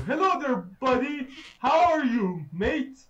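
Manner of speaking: loud, high hello, mate; sarcastic cheer at the monster; mock friendly greeting. Real, not acted.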